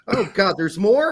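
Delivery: ordinary talk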